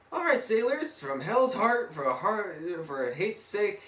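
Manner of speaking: high, loud hammed-up oratory, easing off; mock rallying cry. Speech to the sailors, a loud mock rallying cry. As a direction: call out to the whole crew theatrically.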